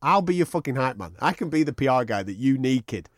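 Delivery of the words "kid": normal talking voice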